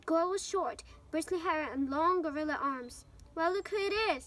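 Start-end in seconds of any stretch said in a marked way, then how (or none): 3.3-4.2 lightly mocking greeting, pitched up; a mild sneer on a raised note; lazy drawl. A narrator's reading. Malfoy greets his rivals at the door, lazy drawl. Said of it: "in his usually lazy drawl".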